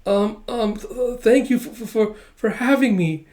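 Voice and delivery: high, halting, flustered stutter; acted bashful stammering; nervous stammer. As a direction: trip over your thanks, starstruck. Flustered guest stammers before crush.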